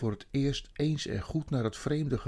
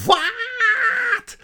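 one long, high screamed word; enraged shriek; 14 dB louder than usual. A narrator's reading. Witch hears who brought the food, shrieking with rage. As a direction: explode in outraged disbelief. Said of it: "De tovenares sprong uit haar vel".

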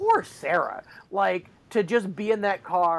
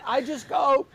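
ordinary delivery

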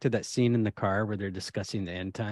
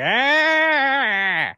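high mock scream; 13 semitones higher, 4 dB louder than usual. Imitating Slimer scene scream.

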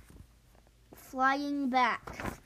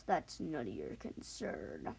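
cold dismissal; 11 semitones lower, 10 dB quieter, slower than usual. Acted. Mysterious dragon rebuffs question.

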